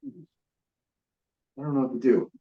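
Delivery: normal talking voice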